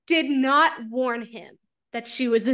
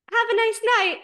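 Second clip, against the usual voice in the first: higher, bright mock-sweet goodbye; sweet dismissal; 10 semitones higher, 4 dB louder than usual. Mocking the mate dismissing Azriel, higher and sweet. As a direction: brush him off sweetly. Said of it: "his mate is like".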